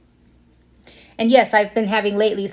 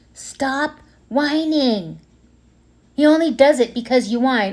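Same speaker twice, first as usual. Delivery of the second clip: mother yelling; 4 semitones higher than usual. Retold or imitated. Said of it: "would yell at me".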